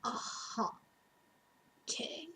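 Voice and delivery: resigned murmur. Retold or imitated. Retold resigned reply to counselor.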